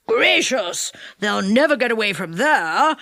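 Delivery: mildly worried character voice; anxious. A narrator's reading. What's-His-Name hearing his friends are trapped, mildly anxious. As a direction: fret over friends, without raising the voice much. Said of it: "said What's-His-Name anxiously".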